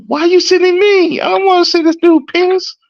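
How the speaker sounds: high-pitched, exaggerated whining complaint; whiny protest. Officer objecting to his supervisor about the transport, whiny protest.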